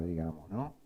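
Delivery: ordinary talk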